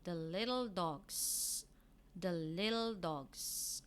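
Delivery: "the little dogs" said slowly, leaning on "little"; slow teacher model of "the little dogs"; model reading. Shown as a demonstration. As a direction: pronounce "the little dogs" for the class to repeat. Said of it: "we have here".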